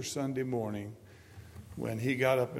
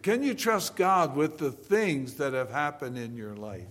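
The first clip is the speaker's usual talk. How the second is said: ordinary talk, nothing marked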